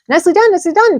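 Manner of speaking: very high, loud honking goose cries; a goose barking orders to the pig; shrill-pitched urgent goose shouts; excited goose. A narrator's reading. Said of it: "cried the goose".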